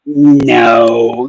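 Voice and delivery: ordinary delivery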